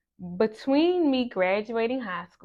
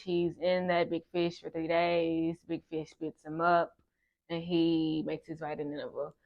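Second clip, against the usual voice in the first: usual speaking voice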